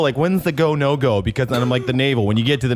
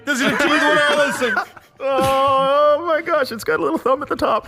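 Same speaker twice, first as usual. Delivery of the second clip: high squealing fake panic; mock scared squeal; 10 semitones higher than usual. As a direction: squeal like a frightened kid. Mocking scared caller, high voice.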